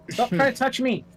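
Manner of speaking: high, loud yell; shout. Acted. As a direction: shout it, fending someone off. Player yelling at game enemy.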